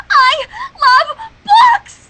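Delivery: high, loud ecstatic shout; ecstatic cry. Acted. Reader voices Twilight crying out, high and loud. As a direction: fling it out at the top of the voice.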